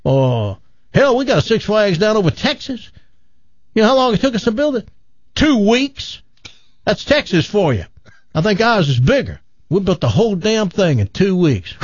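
high, scoffing boastful Texan; bragging Texan. Acted. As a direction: scoff and brag like a big-talking Texan. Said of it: "He goes".

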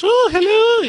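very high, loud mock queen; shrill falsetto imitation of royalty. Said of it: "they're putting on the high voice".

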